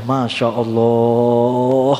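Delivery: low, long-stretched marvel; awed exclamation. Retold or imitated. Preacher describing the heavenly maiden's voice, drawn-out awe. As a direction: linger on the final vowel in wonder.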